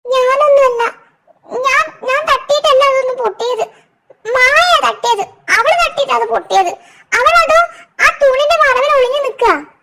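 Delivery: put-on very high, loud girlish wail; tearful girl character. Girl denying she broke the watch, tearful high wail. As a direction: protest in a squeaky, crying little-girl voice. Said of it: "Ami കരയുന്നത് കണ്ട്".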